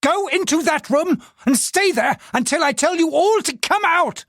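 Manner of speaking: raging threat. Acted. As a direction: threaten them, barely in control. Husband threatening servants in rage.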